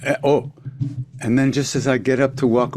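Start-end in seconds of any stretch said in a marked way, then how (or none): none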